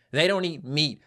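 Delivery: ordinary delivery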